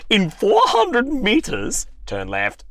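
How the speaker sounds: slow, high, loud navigator voice; satnav voice. Acted. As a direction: stretch the directions like a GPS. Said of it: "they sound like they've come from different places".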